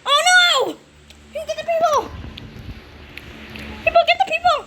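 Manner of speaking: very high, urgent yells; alarmed shouting. Pretend. Player urging rescue of the people, high frantic shouts.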